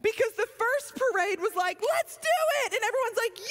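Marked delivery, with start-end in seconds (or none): 1.8-2.7 excited cheer. Retold or imitated. First parade crowd hype.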